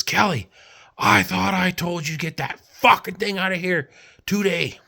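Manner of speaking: retold angry yell. Oil field boss yelling at Kelly to remove truck, angry high yell. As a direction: bark it like a furious boss. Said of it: "this guy comes screaming up".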